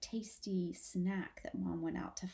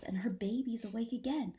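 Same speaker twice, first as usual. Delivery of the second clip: plain reading voice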